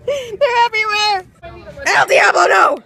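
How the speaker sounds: high, very loud cry for mercy; panicked shouting. Real, not acted. Trampled person pleading with El Diablo the goat, panicked shout. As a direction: yell as goats swarm over you. Screaming while trampled by goats.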